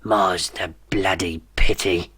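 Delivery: put-on slow, feeble old tyrant's voice; dying dictator to his son, feeble and slow; feeble whisper. A narrator's reading. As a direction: grumble it out half-dead and slow. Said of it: "in a feeble whisper".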